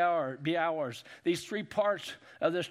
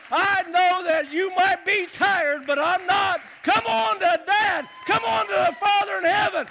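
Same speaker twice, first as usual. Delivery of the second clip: God calling out; 9 semitones higher, 9 dB louder than usual. Acted. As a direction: call your child home at full voice, overjoyed. God voiced calling his child.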